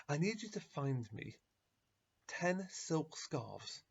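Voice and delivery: drawn-out, slow, insistent request; slow insistence. Acted. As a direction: lay out each word slowly and firmly. Rabbi insists on his request.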